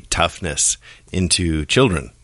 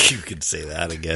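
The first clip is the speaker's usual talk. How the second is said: much softer, under the breath; hushed throwaway agreement; soft sarcastic echo; 2 semitones lower, 9 dB quieter than usual. Acted. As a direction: echo it back quietly, wryly. Echoes the sarcastic line back softly.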